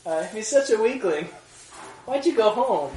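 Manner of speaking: a pushy questioner catching a slip; mock skeptic. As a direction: play the puzzled listener.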